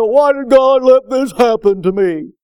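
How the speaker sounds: loud, put-on wail of blame; complaining protest. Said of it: "immediately start saying".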